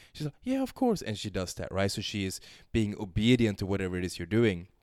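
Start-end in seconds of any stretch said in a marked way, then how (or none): 0.4-1.0 much higher eager voice; mock eager girl. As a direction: chirp it back obediently. Girl agreeing, quoted.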